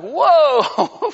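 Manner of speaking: loud, high, drawn-out whoa; mock alarm. New convert hit by persecution, mock alarm. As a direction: pull back in exaggerated shock. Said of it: "They're like".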